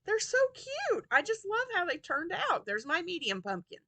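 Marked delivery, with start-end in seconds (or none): 0.1-2.7 gushing. Real, not acted. Gushing over finished crochet pumpkins.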